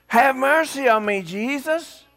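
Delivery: high, loud plea for mercy; desperate hollering. Retold or imitated. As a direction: cry out as loud as you can. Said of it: "kept hollering".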